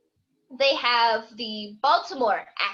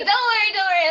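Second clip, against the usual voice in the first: higher, louder retold reassurance; hurried reassurance; 8 semitones higher, 7 dB louder, much faster than usual. Daughter to her mother about Karens, hurried reassurance. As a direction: quickly wave the worry away, raised voice.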